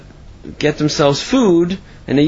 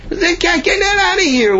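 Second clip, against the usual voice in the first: angry outcry. Acted. Tenants ordering the man to remove the cat, crying out. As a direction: order it gone in outrage.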